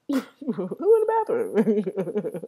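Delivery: high, loud words through laughter; laughing. Real, not acted. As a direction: repeat it while cracking up. Cracks up repeating own example.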